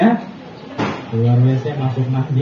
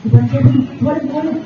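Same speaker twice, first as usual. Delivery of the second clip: scolding parent; 6 semitones higher, much faster than usual. Retold or imitated. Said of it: "Nah, gitu tuh".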